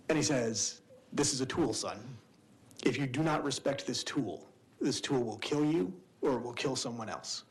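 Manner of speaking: normal talking voice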